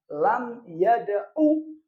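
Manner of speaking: lam yada'u paced slowly with the last u cut short; slow teacher's demo, yada'u ending short; short final vowel. Shown as a demonstration. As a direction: stretch out lam yada'u but stop on a short 'u.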